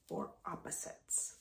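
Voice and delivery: soft unvoiced whisper. Real, not acted. Teacher whispering to the class.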